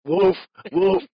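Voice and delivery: a playful imitation of a dog's bark; imitating a dog bark. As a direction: bark it out like the old dog did. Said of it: "she went".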